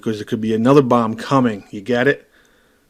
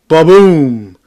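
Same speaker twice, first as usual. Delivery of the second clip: loud mock ba-boom; bump sound effect; 3 semitones higher, 9 dB louder than usual. Mimicking the wheelchair hitting the bump, loud ba-boom. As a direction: blurt the thud of the bump.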